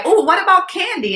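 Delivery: high mock-hopeful question; aunt teasing her niece with a high voice. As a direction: tempt the kid playfully. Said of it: "And I'm like".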